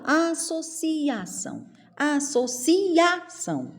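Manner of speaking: "Associação" high and slow as a-so-CI-A-ção; split vowels. Shown as a demonstration. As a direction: break ci and a into two beats. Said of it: "eu não falo".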